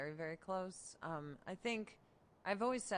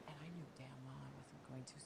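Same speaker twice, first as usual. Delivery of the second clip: whispering; 5 semitones lower, 12 dB quieter than usual. Retold or imitated. Reunion invite, whispered aside.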